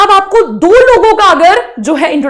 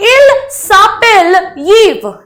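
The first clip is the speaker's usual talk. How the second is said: slow model sentence; 3 semitones higher, much slower than usual. Shown as a demonstration. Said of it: "कह रही है".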